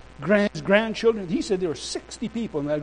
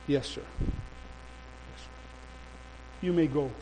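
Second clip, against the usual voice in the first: hushed, lower, deflated; subdued compliance; 4 semitones lower, 12 dB quieter, slower than usual. Retold or imitated. Immigration minister releasing preacher after phone call, subdued. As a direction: give in softly to authority.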